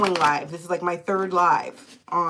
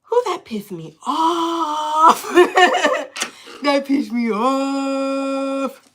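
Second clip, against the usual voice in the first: slow, half-laughing, half-crying outrage; real riled-up laugh-cry, slow; laughing indignation; 5 semitones higher, 3 dB louder, much slower than usual. Real, not acted. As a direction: laugh through the disbelief, stretching the words out. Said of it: "Let me calm down".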